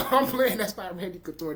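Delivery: laughing through a playful take-back. Real, not acted. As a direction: laugh while admitting you're kidding. Joking about misnamed fighter.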